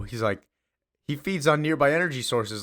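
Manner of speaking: usual speaking voice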